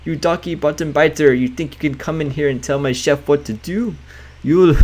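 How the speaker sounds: put-on an indignant chef in a thick French accent; a loud, accosting French chef accent. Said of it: "In a thick French accent".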